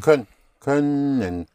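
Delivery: "können" with lips pointed, slow; slow "Kön-, können", lips rounded and pushed forward. Shown as a demonstration. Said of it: "you point your lips little".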